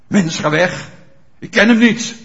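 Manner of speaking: loud, forceful brush-off; hammed-up irritated disowning; acted angry denial. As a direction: push them away with a loud denial. Acted Peter denies knowing Jesus.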